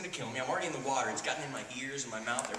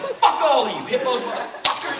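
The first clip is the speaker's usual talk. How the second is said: higher, much louder, furious cursing; furious yell; 10 semitones higher, 12 dB louder than usual. Retold or imitated. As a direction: scream it at the hippos with everything. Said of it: "yelling at the top of my lungs".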